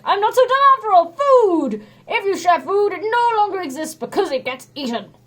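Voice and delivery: smug booming strongman; proud hero. A narrator's reading. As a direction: crow over the answer. Hercules voiced in story.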